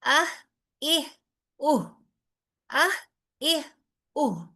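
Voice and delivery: اہ اہ اوہ said as clipped glottal stops; hamza sound demo. Shown as a demonstration. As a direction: cut each vowel short at the throat. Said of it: "اس word کو ساکن کر لیجئے". (ہمزہ کی آواز کا عملی نمونہ)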